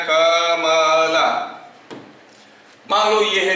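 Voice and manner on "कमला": drawn-out syllables. Shown as a demonstration. Teacher stretching a word for the class, very slow. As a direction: stretch out कमला slowly, syllable by syllable. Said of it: "कोई एक sound create कर रहा है".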